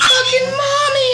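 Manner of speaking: put-on high, loud child's wail; screaming toddler. Mom imitating her screaming son, high wail. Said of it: "He's screaming".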